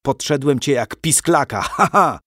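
the bandit chief's gloating, laughing boast; gloating cackle. Acted. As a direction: crow over him, then cackle. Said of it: "zarechotał herszt".

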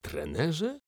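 can't believe the word; astonished question. A narrator's reading. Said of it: "zdumiał się Severus".

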